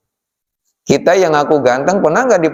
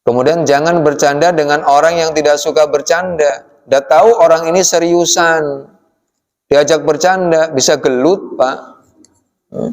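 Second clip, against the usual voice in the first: ordinary talk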